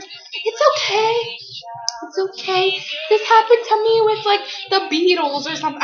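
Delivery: lightly hammed-up grandma comfort; imitating grandma. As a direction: soothe a crying child in a high voice. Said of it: "my grandma was like".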